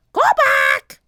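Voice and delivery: very high, loud parrot screech; high loud squawk. A narrator's reading. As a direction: screech the warning. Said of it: "squawked Polly".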